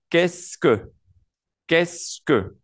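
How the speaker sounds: "qu'est-ce que" pronounced kess-kuh; four words as one. Shown as a demonstration. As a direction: model "qu'est-ce que" in two clear syllables, kess, kuh. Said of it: "esas primeras cuatro palabras suenan".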